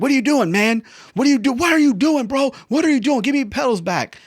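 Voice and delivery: loud, high, frantic mock yelling; hammed-up screaming flight instructor. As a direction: berate the student in a panicked shriek. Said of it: "He's just screaming".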